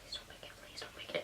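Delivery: barely audible pleading whisper. Retold or imitated. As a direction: beg under your breath. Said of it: "I was, like, whispering it".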